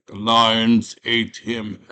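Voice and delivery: slow, loud, drawn-out royal echo; hammed-up monarch, each word stretched; mock king. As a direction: repeat it deliberately as a doubting king. King repeats the captives' lie.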